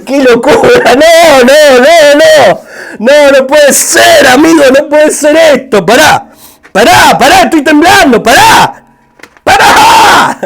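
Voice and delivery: loud, high repeated protests; overwhelmed disbelief. Real, not acted. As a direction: cry out in amazed disbelief, building to a drawn-out shout. Overwhelmed reaction to the music video.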